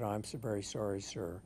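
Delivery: softly voiced, deferential apology; acted humble switchboard operator; meek apology. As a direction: apologise meekly to a superior, dropping low on sir. Acted apology to angry British caller.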